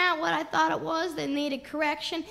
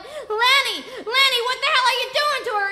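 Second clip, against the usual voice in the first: high, loud, hurried demand; yelling; 7 semitones higher, 7 dB louder than usual. Retold or imitated. Mother runs upstairs to stop him, yelling. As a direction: shout his name up high, then rush on. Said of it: "tripping halfway up and yelling".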